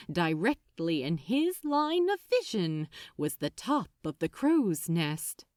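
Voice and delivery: ordinary delivery, nothing marked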